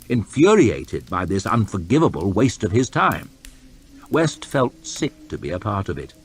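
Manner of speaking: usual speaking voice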